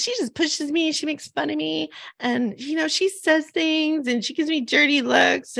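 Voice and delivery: put-on high, softish child voice listing grievances; high-pitched retold tattling; complaining little girl. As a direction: imitate a small girl telling on a friend. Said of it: "And she goes".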